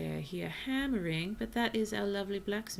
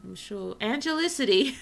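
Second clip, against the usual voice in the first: exasperated shout; 5 semitones higher, 10 dB louder than usual. Real, not acted. Exasperated at same villager.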